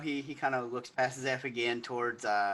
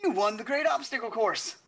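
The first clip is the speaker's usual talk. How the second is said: gushing noble; 11 semitones higher, 6 dB louder than usual. Acted. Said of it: "he's like".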